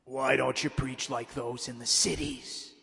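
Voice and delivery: a hint of a grotesque, taunting demon; low grotesque devil taunt. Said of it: "with his grotesque voice".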